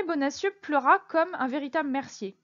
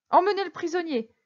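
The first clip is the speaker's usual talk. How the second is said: raised, louder order to guards; commanding; 6 semitones higher, 5 dB louder than usual. Acted. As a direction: give a firm official order. Commissioner orders guards to take prisoner.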